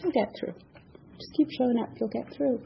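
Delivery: quiet, gentle comfort; soft reassuring sponsor. Retold or imitated. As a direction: soothe a crying friend. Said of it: "She's like".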